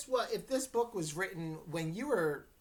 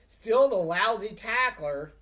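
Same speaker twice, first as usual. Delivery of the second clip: louder, mocking jeer; loud laughing taunt; 4 semitones higher, 9 dB louder than usual. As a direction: Kavanaugh jeering at Wallace while running off, loud laughing taunt. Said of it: "he laughed".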